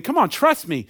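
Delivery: put-on a dad in the pool calling to his child on the edge, cheering him on; encouraging dad. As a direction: coax the kid to jump from far back. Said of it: "He's saying".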